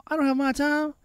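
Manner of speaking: high mock protest; hammed-up henpecked husband; whiny husband. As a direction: whine back at the wife. Husband voiced in story.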